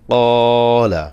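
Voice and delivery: slow teaching demo of a long vowel; stretched vowel demo. Shown as a demonstration. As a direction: draw out the কা in কলা and keep লা short. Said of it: "কফে টান হবে".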